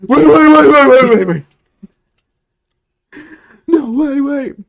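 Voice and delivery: high, loud frantic pleading, repeated nonstop; hammed-up movie scream, begging them to stop; Jon Bernthal yelling. Host copies a captured prisoner pleading with guards, mock frantic yelling. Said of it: "But he yells it nonstop".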